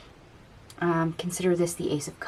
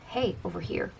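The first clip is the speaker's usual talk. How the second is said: Hey, over here repeated much softer; softer echo; 8 dB quieter than usual. Retold or imitated. Universe nudging the client again, a softer echo. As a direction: let the second Hey, over here fall away quietly.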